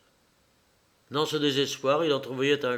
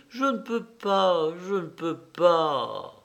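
high, slow, anguished plea; choked refusal; 5 semitones higher, slower than usual. A narrator's reading. Son refuses his insisting mother, voice choking. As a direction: plead slowly as if the throat tightens. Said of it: "Sa voix s'étrangla".

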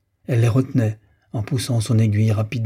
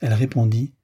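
usual speaking voice

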